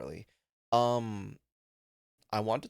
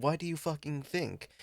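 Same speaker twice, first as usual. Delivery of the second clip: higher-pitched indignant comeback; exasperated retort; 7 semitones higher than usual. Retold or imitated. Daughter retorting to her dad over her crying, exasperated. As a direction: retell an incredulous snap back.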